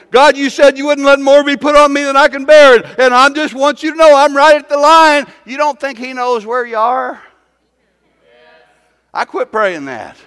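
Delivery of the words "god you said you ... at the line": loud, high plea to God; acted desperate shouting prayer; breaking-point prayer. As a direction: cry out as if pushed to the limit.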